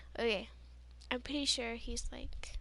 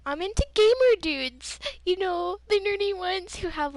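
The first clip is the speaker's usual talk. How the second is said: louder, higher, gushing retort; emphatic declaration; 8 semitones higher, 9 dB louder than usual. Retold or imitated. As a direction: proudly announce your type. Retorting to jocks about her type.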